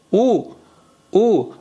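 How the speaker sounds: "او" as a pure u, lips round; rounded forward vowel. Shown as a demonstration. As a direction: push jaw and lips forward for "او", an u sound. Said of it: "فک میاد جلو وقت تلفظ".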